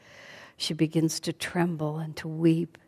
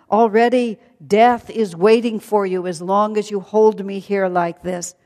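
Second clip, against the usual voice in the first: raised voice, scolding the demon king; courageous rebuke; 3 semitones higher, 8 dB louder than usual. Acted. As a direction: stand tall and rebuke him loudly. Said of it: "she gathers up her courage".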